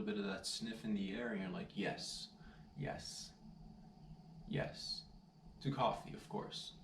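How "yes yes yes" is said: breathy, soft yes yes yes; blissful whisper. Retold or imitated. You, smelling fresh coffee brew, whisper blissfully.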